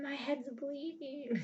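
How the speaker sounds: high, slow stretched wail; hammed-up fake panic; high mock whine. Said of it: "and he freaks".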